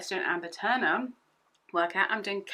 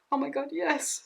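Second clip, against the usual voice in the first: higher, thrilled little squeal of a yes; mock excited; 7 semitones higher than usual. Retelling her reaction to the Instagram comment, mock excited. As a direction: replay the thrill lightly.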